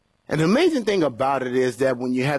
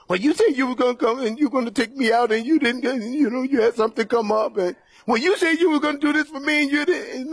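higher, fast whiny complaint, near tears; whiny complaining partner; 9 semitones higher than usual. Retold or imitated. Preacher mimicking a partner complaining about broken plans, high whine. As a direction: whine like a let-down lover.

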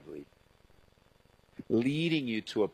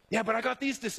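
whiny protest; 10 semitones higher, faster than usual. Retold or imitated. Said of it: "Ed protesting to God".

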